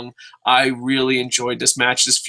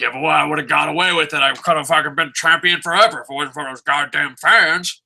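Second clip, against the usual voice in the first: lightly voiced thwarted villain; hammed-up villain's complaint; mock villain voice. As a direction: act out a foiled bad guy blaming the fans. Voices whining wrestlers blaming fans.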